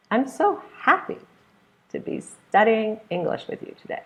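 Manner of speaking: HAPPY lifted high on the first syllable; stress on happy. Shown as a demonstration. Teacher giving an example of first-syllable stress in happy, emphatic.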